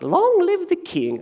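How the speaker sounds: high, strained herald cry; hammed-up courtier hailing the king; mock herald voice. Preacher voicing courtiers hailing the king, high mock cry. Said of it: "my voice isn't ready for that".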